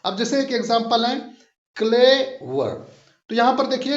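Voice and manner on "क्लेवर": क्लेवर slowly, first syllable clay drawn out, वर shorter; stress on first syllable. Shown as a demonstration. Teacher showing learners syllables, first-syllable stress on क्लेवर. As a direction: stretch the clay of क्लेवर, then drop onto वर. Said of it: "पहले को हम खींच के पढ़ रहे हैं".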